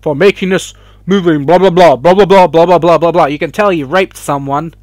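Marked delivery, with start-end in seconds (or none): 0.0-3.2 hammed-up pundit voice, building to loud blah blah; mock the anchor, then drown him out; sarcastic imitation of an Indian news host. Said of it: "He's saying".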